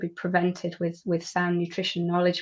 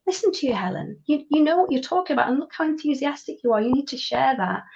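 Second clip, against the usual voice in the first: higher-pitched voice of a little inner self; 6 semitones higher than usual. Acted. Speaker's little inner self urging them to share what they know, pitched-up encouragement. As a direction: voice your own inner cheerleader, pitched up. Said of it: "little version of me stood here going".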